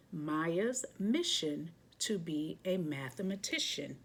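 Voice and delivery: plain reading voice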